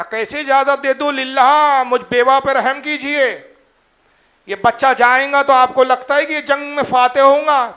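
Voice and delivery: pleading cry. Acted. Widowed mother begs Abu Bakr to spare her son, pleading loudly. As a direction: beg for mercy, voice lifted.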